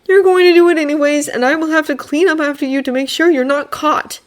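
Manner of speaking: high, resigned weary voice; despairing. Acted. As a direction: resign yourself to it. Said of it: "in despair".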